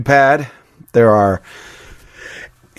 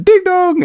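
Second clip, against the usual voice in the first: high slow sing-song chime; mock doorbell ding dong; imitated doorbell.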